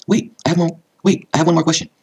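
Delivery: high-pitched, hurried interjection; urgent plea. A narrator's reading. Daughter catching her mother for one last question, high urgent plea. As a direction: stop someone before they go.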